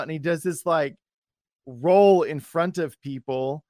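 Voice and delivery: normal talking voice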